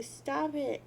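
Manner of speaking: usual speaking voice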